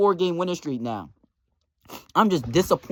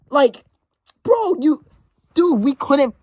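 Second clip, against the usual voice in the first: much higher and louder, words failing; sputtering outrage; 8 semitones higher, 5 dB louder than usual. Real, not acted. Manager blowing up at a rival, sputtering high and loud. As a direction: burst out in disbelief.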